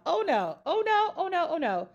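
sing-song, pitch leaping high then falling back; playful refusal. Real, not acted. Speaker refuses to take on God's job, playfully adamant. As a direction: wave it off in quick repeated refusals.

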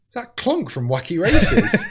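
slow, high baffled question. Retold or imitated. Comic on stage baffled by noises from the crowd, slow high puzzlement. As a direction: puzzle over the strange noise aloud.

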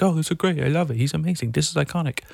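gushing fans. Retold or imitated. Fans raving about the show, mock gushing. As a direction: imitate fans fawning over a singer.

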